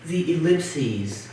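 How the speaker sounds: measured, deadpan list of titles; poem reading. Acted. Poet reading invented titles to an audience, measured poem reading. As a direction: let each title stand alone, with long pauses.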